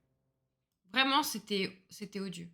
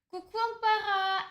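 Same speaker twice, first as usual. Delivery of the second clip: sing-song greeting; 11 semitones higher than usual. Real, not acted. Greeting a viewer in chat.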